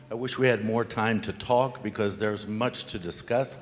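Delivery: normal talking voice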